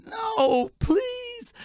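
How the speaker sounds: high, pleading squeak; whiny plea. Retold or imitated. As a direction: beg in a little high voice. Pleading retold.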